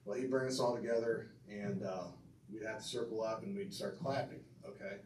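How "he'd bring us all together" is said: normal talking voice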